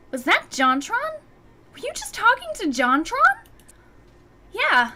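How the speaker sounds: high, eager squeal of a question; excited gasp. Acted. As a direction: gush like a starstruck friend. Excited friend Mai voiced.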